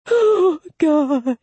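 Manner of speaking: high, wailing sob; sobbing. A narrator's reading. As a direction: sob it out in despair. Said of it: "The man sobbed".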